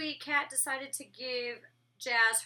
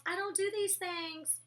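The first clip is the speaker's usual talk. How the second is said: mocking Jazz; 7 semitones higher, slower than usual. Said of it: "in quotes".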